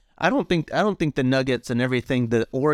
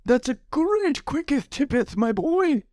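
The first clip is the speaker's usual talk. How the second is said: put-on old English; 12 semitones higher, slower than usual. Playing old father figure.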